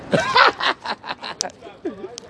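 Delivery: shrieking laugh way up; high giggling cackle. Real, not acted. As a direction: cackle after teasing him.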